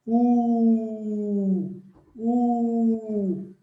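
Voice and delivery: long U held with puckered lips; pouted U. Shown as a demonstration. Teacher shows class the little pout, held U. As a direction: pout and sustain U, twice. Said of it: "o U é um biquinho".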